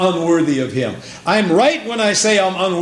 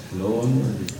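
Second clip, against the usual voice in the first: softer distant echo of Hello, unworthy; echo coming back; 6 semitones lower, 7 dB quieter than usual. Pretend. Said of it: "I hear it coming back".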